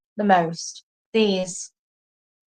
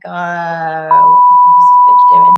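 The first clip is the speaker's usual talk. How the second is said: shrieking mock Dallas chick; mock Dallas girl; 3 semitones lower, 12 dB louder than usual. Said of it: "and they're like".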